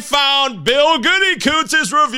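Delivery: high, loud, drawn-out excited announcement; triumphant yell, stretched at the end. Real, not acted. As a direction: scream it out like thrilling news. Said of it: "That's why I screens ... I just get so excited".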